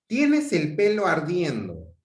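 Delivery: urgent alarmed cry; exclamation. Shown as a demonstration. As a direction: shout it as if the hair is really on fire. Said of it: "aquí ya hay una entonación diferente".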